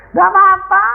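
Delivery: high, loud shout of nggak apa-apa; loud cry. Retold or imitated. Preacher shouting his answer to God. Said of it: "saya bilang".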